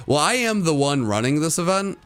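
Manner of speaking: ordinary delivery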